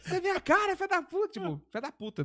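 very high-pitched playful cursing; mock exclamation. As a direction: curse a stranger in a joking falsetto. Mimes reacting to a matching stranger.

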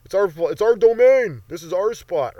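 loud, high proclamation of ownership; triumphant claiming. Acted. Occupiers declaring the building theirs, loud and triumphant. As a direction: stake the claim at full voice. Acting rioters taking over building.

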